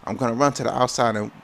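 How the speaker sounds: normal talking voice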